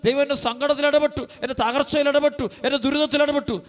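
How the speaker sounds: high, impassioned sermon voice; fervent preaching. Acted. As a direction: preach it out with rising fervour. Preacher builds to high testimony. (ഉയർന്ന സ്വരത്തിൽ ആവേശത്തോടെ പ്രസംഗം)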